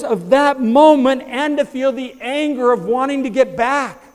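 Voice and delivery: high, loud, slowing preacher's climax; preaching at full force. Acted. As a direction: lift the congregation with rising fervor.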